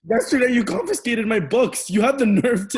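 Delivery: high, loud, indignant accusation; shouting. Acted. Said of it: "Stop that shouting".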